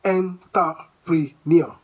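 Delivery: entrepreneur said slowly, syllable by syllable, as en-ta-pree-NEER; mispronounced entrepreneur. Shown as a demonstration. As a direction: spell out entrepreneur the wrong way, "en-ta-pri-nio", slowly. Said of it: "Do you say".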